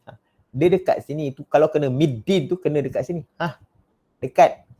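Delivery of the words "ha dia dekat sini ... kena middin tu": ordinary talk, nothing marked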